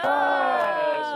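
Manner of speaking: loud, high triumphant yes; excited shout. Real, not acted. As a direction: shout it out like a game-show win. Host confirms guess triumphantly.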